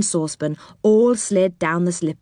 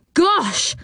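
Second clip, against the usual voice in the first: alarmed gosh; 11 semitones higher than usual. A narrator's reading. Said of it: "said Joe in alarm".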